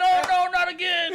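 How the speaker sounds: mock whine. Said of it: "He said".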